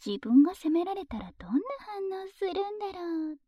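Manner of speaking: ordinary delivery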